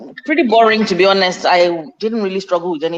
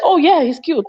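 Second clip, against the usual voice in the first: much higher, bright and breezy as if unbothered; mock cool mum; 10 semitones higher, 3 dB louder than usual. Mum answering her daughter's crush talk, mock casual. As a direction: play the relaxed mum hiding her alarm. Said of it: "I'm like".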